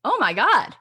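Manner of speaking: mock stunned, caught off guard; imitating her own amazement, rising loud; stunned disbelief. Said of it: "And I was like".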